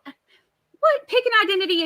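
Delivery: high, loud exclamation of disbelief; incredulous. Acted. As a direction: pitch it way up, as if baffled. Incredulous reaction to fake accent.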